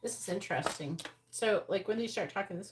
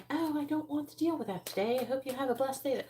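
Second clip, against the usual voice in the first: high, sweet fake-polite voice; sugary mock nice; 7 semitones higher than usual. Mocking a too-nice person setting boundaries, high sugary sweetness. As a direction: sound sickly sweet and nice. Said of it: "than if you say".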